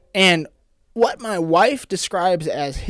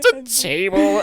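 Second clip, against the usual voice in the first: choked up; 3 semitones higher, slower than usual. Retold or imitated. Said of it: "I couldn't even say words".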